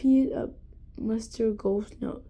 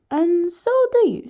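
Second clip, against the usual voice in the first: Scottish gentleman; 6 semitones higher, 6 dB louder than usual. A narrator's reading. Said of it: "speaking like the gentleman from Scotland".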